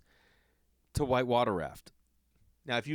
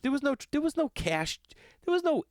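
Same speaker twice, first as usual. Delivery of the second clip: exasperated; 3 dB louder than usual. Real, not acted. Worked-up rant.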